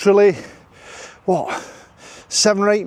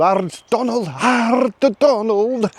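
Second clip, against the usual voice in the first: hammed-up Scottish "Ard", higher and stretched out; 6 semitones higher, much slower than usual. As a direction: draw it out in a mock Scottish accent. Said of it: "stereotypical generic Scottish accent".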